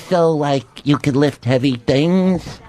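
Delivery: slow, credulous agent imitation; Dennis. Agent asking Superman about his powers, slow mock-credulous imitation. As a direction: draw the question out like someone half convinced. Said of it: "He goes".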